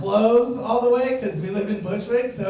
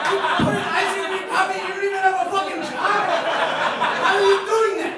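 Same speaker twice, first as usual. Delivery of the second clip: incredulous rant; 9 semitones higher, faster than usual. Retold or imitated. Ranting at the jobless roommate about ice cream coffee, outraged high yell. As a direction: blow up at him in outrage.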